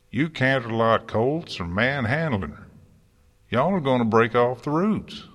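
fretful country farmer barking orders; fretsome bark. A narrator's reading. Farmer scolding gardeners over a sapling, fretsome bark. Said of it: "Applejack's fretsome voice occasionally barked an order".

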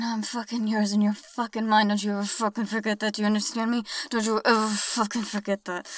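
loud, forceful declaration; loud and domineering, insisting; possessive command. Acted. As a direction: drive every word home like an order. Possessive commands, loudest stretch.

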